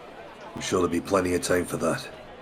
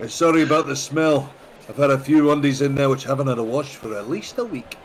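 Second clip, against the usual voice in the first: raised, loud rowdy traveller voice; high loud adventurer; 7 semitones higher, 3 dB louder than usual. Acted. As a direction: bluster in as a rowdy newcomer. Character continues in voice.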